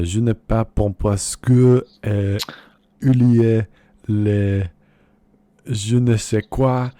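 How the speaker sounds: loud, slow put-on French gibberish; mock French. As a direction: bluff through schoolboy French. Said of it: "Okay, I'm gonna try".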